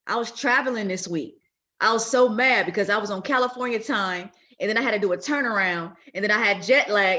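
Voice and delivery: loud, exasperated retelling of a chaotic week; worked-up rant. Real, not acted. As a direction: vent it loudly, still fired up. Frustrated retelling of jet-lagged week.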